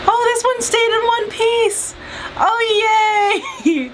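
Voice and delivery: high delighted squeal; thrilled little cheer; excited. Real, not acted. Delighted roll stayed intact.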